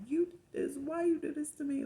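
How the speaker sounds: loud, high accusing yell; cussing out. Retold or imitated. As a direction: blame him at the top of your voice. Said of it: "I'm cussing my kid's dad out".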